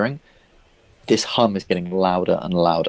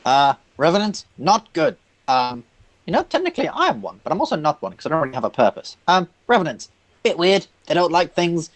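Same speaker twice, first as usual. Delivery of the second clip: lightly fretful high-pitched character voice; Cathaniel; 8 semitones higher than usual. Acted. Character answering a question about revenants, high-pitched voice. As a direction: answer haltingly in a much higher voice. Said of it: "what do you know about revenants".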